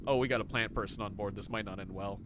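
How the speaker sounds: usual speaking voice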